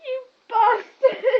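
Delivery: loud, slow shout through hiccups; shouted curse. Real, not acted. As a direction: yell it at him, drawn out. Hiccuping friend cursing the cameraman.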